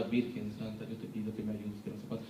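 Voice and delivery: mock rich man praying under his breath; imitating a prayer.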